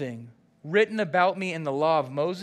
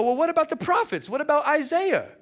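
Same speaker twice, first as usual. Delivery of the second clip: much higher and louder, quick and eager; eager disciples; 10 semitones higher, 5 dB louder than usual. Retold or imitated. Disciples quizzing Jesus, high and eager. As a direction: keep pushing with the next question. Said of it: "They go".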